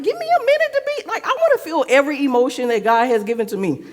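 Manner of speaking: high, fast, worked-up outburst; mock upset protest. Acting her upset reply.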